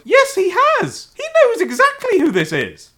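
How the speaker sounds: high-pitched excited outburst; excited realisation. Retold or imitated. Telling co-host of a plot realisation, excited high outburst. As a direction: exclaim it high, as a sudden revelation.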